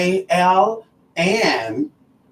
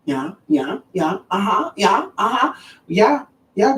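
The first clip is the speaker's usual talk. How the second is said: high-pitched put-on polite yeahs; mock-polite, higher-pitched chirps of agreement; 6 semitones higher than usual.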